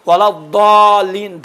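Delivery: الضالین with a hard, drawn-out daal sound; zaad as daal. Shown as a demonstration. Teacher showing clerics a wrong letter, الضالین said with daal. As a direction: stretch الضالین slowly, daal in place of zaad. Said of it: "دال ہے".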